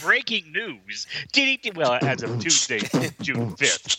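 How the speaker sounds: slow, dramatic news intro; announcer voice. Acted. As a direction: announce it like a newscast. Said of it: "announcing segment".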